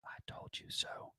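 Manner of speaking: creepy breathy whisper into the mic; creepy Joe Biden whisper. Pretend. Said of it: "creepy Joe Biden whisper".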